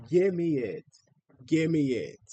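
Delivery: ordinary talk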